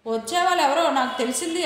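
plain reading voice

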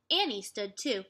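ordinary delivery, nothing marked

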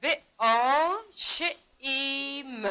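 ب، آ، ش، ایم sound by sound, very slow; separated letter sounds ب، آ، ش، ایم with long vowels. Shown as a demonstration. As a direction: stretch آ and ایم in ب، آ، ش، ایم. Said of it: "اگر این را صدا کشی کنیم".

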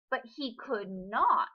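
usual speaking voice

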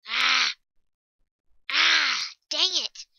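loud frustrated yells; angry outburst. Real, not acted. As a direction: burst out in real frustration at the game. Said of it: "I got a little angry".

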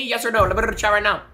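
normal talking voice

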